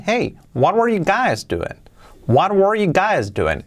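"Hey, what were you guys doing" pitched high with big swoops; sing-song lift on "Hey" and "were you guys"; with intonation. Shown as a demonstration. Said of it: "Con entonación".

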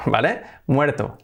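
ordinary talk

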